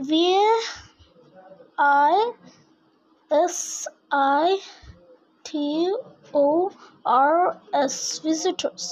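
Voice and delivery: each letter alone, long pauses between; slow letter-by-letter spelling. Shown as a demonstration. Teacher showing the class how to spell a word, slow letter by letter. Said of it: "This is how we do syllables".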